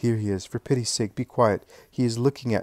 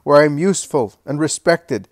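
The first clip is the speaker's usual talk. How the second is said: higher, louder defiant shout; 5 semitones higher, 9 dB louder than usual. A narrator's reading. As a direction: fling it back over his shoulder. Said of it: "turns round and shouts".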